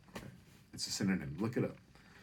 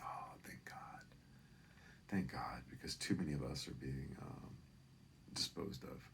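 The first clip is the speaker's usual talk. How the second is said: whispered, softer thanks; hushed relief; 5 semitones lower, 11 dB quieter than usual. Retold or imitated. Comic answering Lisa about son, hushed relief. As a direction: breathe relief barely voiced.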